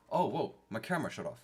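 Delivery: normal talking voice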